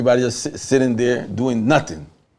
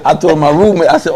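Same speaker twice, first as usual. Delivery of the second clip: usual speaking voice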